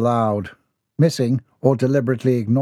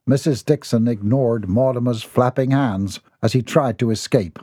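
ordinary delivery

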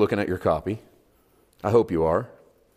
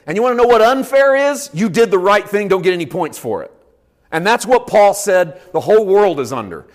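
loud, high, insistent sermon; forceful preaching; 9 semitones higher, 7 dB louder than usual. Real, not acted. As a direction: press the point hard from the pulpit.

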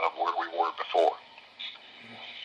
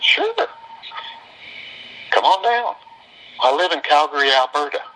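Shirley's voice; 9 semitones higher than usual. Retold or imitated. Voicing Shirley on phone.

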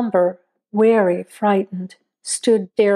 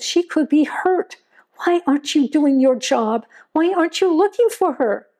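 higher and higher, building from tense to frantic; frantic demand; 6 semitones higher than usual. A narrator's reading. Mother demanding the sheriff go search, frantic and rising. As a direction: throw every question at him, rising in panic. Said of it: "Tudor demanded".

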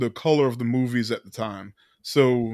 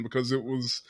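ordinary talk

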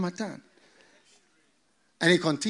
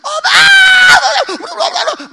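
high loud mock scream; 13 dB louder than usual. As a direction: scream as the water takes you. Drowning scream.

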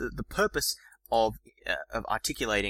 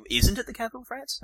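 much higher, incredulous question; you can't believe it; puzzled disbelief, pitched high; confused question; 13 semitones higher than usual. Shown as a demonstration. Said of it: "in a sort of confused way".

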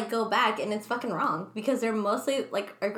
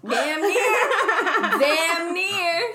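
high, loud, laugh-filled punchline; laughing exclamation; 7 semitones higher, 6 dB louder, much slower than usual. Retold or imitated. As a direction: crack up loudly on your own joke. Joking answer, both laughing.

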